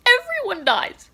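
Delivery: high, loud wail; dramatic announcement. Real, not acted. Reader reveals the ending to viewers, dramatically wailing.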